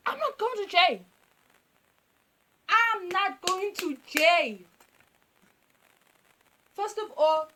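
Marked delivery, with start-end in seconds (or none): 0.1-1.0 high, loud protest at normal speed; loud, high-pitched refusal; panicked protest. Acted. Protesting the police threat. 2.7-4.6 slow, high, loud refusal; deliberate, drawn-out refusal with raised pitch; emphatic protest. Acted.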